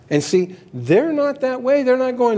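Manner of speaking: high, lifted preaching; rising excitement. Real, not acted. Preacher urging the congregation toward the point. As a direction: build up the energy.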